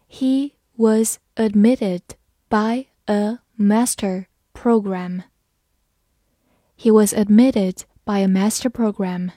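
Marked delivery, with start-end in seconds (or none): 0.1-5.2 slow, careful read-through. Shown as a demonstration. Teacher reads the sentence slowly to students, slow and careful. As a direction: read it slowly word by word for learners. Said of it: "好，慢读一遍".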